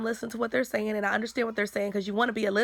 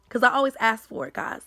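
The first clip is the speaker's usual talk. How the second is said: normal talking voice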